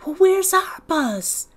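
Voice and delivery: put-on high, slow childish demand; demanding little boy. Little man demanding to know where their bus is, indignant child. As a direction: insist like an indignant small child. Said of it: "demanded little man".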